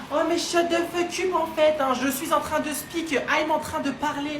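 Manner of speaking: a Parisian shouting a tourist down mid-sentence; angry shout. Acted. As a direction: cut them off with a yell.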